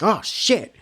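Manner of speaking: high, sudden startled yelp. Retold or imitated. Reviewer recalls being spooked, startled high yelp. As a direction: recall the jump scare with a yelp.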